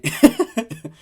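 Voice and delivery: sudden high laugh at a flub; cracking up mid-take; real laugh after a botched line; burst of laughter. Real, not acted. Blooper during recording.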